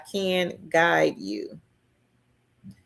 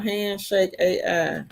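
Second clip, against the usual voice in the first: ordinary talk, nothing marked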